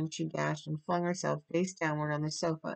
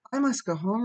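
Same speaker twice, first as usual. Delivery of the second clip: higher, louder insistence; dazed but determined; 7 semitones higher, 5 dB louder than usual. A narrator's reading. Sick Diana insisting to Anne, dazed but determined. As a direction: repeat it doggedly, in a daze. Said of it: "repeated Diana stupidly but determinedly".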